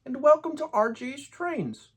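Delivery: high, hammed-up Canadian accent that drifts toward Scottish; terrible Canadian accent. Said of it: "my terrible Canadian accent".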